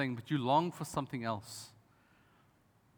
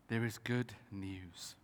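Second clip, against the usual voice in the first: softer and slower, letting it land; quiet, slow repeat; 6 semitones lower, 8 dB quieter, slower than usual. Shown as a demonstration. Pastor quietly repeating the promise to the church, slow. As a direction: hush it, slow and low. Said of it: "But there is good news".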